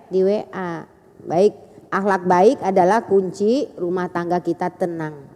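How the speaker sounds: ordinary talk, nothing marked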